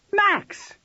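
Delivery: very high, alarmed cry of a name; scream. A narrator's reading. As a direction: scream at him to get his attention. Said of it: "Jackie screamed at him".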